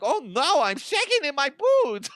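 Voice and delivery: high, mock-frightened squeak; hammed-up fake fear; mock scared. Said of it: "And you're like".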